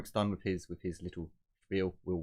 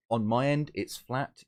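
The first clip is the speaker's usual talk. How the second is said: louder, clearer repeat for a bad line; 6 semitones higher, 6 dB louder than usual. Real, not acted. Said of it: "Oh, sorry, you're really muffled".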